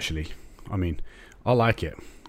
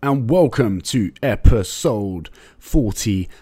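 slow, louder hyped announcer welcome; show announcer intro; 5 semitones higher, 5 dB louder, slower than usual. Acted. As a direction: roll it out like a show opener.

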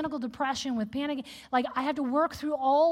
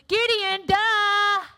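higher, louder mock exasperation; exasperated duh; 7 semitones higher, 6 dB louder, much slower than usual. Readers scolding Gideon, a higher, louder duh. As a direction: roll your eyes at Gideon out loud. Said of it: "we read this and we're like".

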